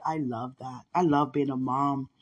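ordinary talk

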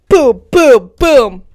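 loud punchy booms; emphatic booms. Real, not acted. Telling a friend the episodes move fast, loud punchy booms. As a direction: punch each boom.

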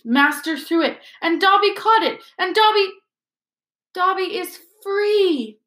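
high elf voice, amazement building; disbelieving elf. A narrator's reading. Elf realising his master has freed him, in disbelief. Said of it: "said Dobby in disbelief".

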